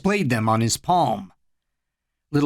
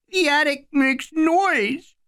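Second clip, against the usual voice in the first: put-on high, small child's voice; a scared little kid, pitched high; little boy voice; 13 semitones higher, slower than usual. A narrator's reading. Little Eddie pleading with his big brother, high childlike voice.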